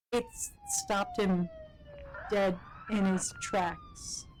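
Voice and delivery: very high, slow and strained; squeaky high voice. Acted. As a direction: squeak the punchline out. Punchline in squeaky voice.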